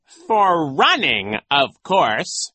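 drawn-out, loud, high reveal; gloating villain. A narrator's reading. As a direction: relish the punchline slowly. Villain reveals his escape.

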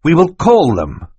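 put-on high, loud boy's declaration; would-be firm. Klaus standing up to the villain, trying to sound firm. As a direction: try hard to sound resolute. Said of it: "hoped was a firm tone of voice".

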